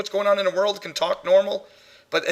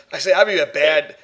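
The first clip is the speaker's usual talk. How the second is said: ordinary talk, nothing marked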